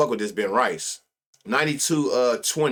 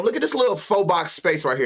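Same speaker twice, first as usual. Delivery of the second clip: ordinary delivery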